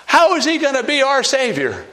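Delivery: mock despairing disciples, pitched high; hammed-up high lament of lost hope; disciples' despair. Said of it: "They thought".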